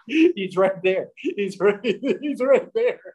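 words squeezed out between helpless laughs; laughing. Retold or imitated. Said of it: "I started laughing, that deep maniacal laugh".

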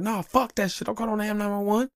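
refusing his wife's plan to call 911; mock high voice. High mock squeal, dismissive. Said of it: "I'm like".

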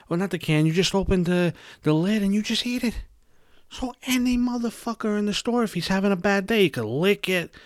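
high, rising disbelief at the risk; outraged rant. Real, not acted. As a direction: climb in pitch as the outrage builds. Outrage over tampering risk.

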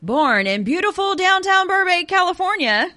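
high, bright game show host. Pretend. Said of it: "That sounded like a game show host".

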